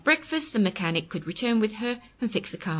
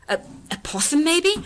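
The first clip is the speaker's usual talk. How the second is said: fearful; 9 semitones higher, 4 dB louder than usual. Acted. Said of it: "invoked a cry of fear".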